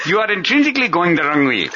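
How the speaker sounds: put-on high lilting Indian accent. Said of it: "the Indian accent".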